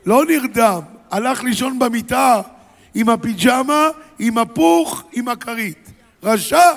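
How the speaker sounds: high and loud, ending in a shouted רשע; indignant build. Real, not acted. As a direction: pile it up and shout the verdict. Indignant preacher about Pharaoh. (דרשן זועם צועק רשע)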